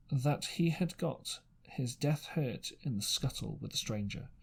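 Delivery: ordinary delivery, nothing marked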